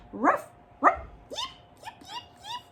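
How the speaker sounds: barks turning to high yips; high squeaky yips; dog yipping. Acted. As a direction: yelp like a stuck little dog. Dog stuck around tree.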